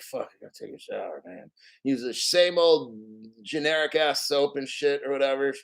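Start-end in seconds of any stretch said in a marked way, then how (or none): 0.0-1.5 low, soft, hurried mutter; mock-weary muttered complaint; reluctant grumble. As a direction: grumble it under your breath, imitating your old reluctant self. Retold old dread of showering.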